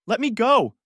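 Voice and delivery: high, loud, struggling cry; gasped plea. A narrator's reading. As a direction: demand release, pitch shooting up. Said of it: "I gasped".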